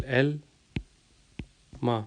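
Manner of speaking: ایلما split in two, El... ma, with a long pause; dipecah per suku kata. Shown as a demonstration. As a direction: break ایلما into El and ma, slowly. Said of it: "word کو جو ہے وہ توڑ کے".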